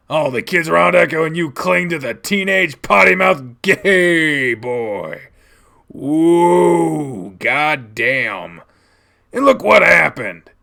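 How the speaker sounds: loud, disgusted fatherly tirade; angry rant. Acted. Dad disgusted by child's friend, angry rant. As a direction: rail at the child, leaning on words.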